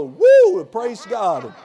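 a very high, loud whoop of praise; joyful woo. Retold or imitated. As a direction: let out a big happy woo. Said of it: "And I'm gonna go".

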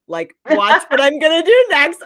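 high, loud, hammed-up boast; playful boast. Acting out God's playful boast.

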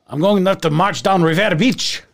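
mock Irish sliding into Slavic; bad Irish accent that sounds Russian or Polish; botched Irish brogue. Leprechaun grumbling about the parade, Irish brogue gone Slavic. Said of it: "It's like this Russian".

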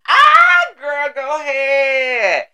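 high slow booming shout; Oprah shout. Retold or imitated. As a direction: belt it out like Oprah.

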